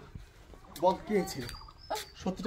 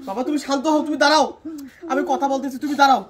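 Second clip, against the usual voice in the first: loud urging; 3 semitones higher, 9 dB louder than usual. Acted. Son shouts at his father to calm down and wait. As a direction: hold your father back by shouting him down.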